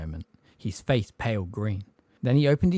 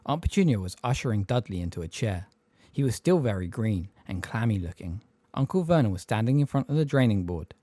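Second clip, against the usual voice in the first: plain reading voice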